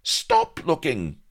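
high, loud, slow protest; indignant cry. A narrator's reading. Vanity crying out at Blood to stop looking. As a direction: order him to stop staring. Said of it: "cried Vanity".

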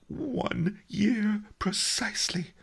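slow, high, airy confirmation; high-voiced master, drawing out each word; mournful old master. A narrator's reading. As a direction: answer with wounded precision. Master Li answers in figure voice.

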